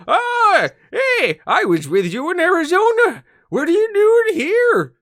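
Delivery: hammed-up drunk cowboy, slowing on the question; drunken Western guy. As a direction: play a tipsy old prospector, loud and high. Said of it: "He's like a drunken Western guy".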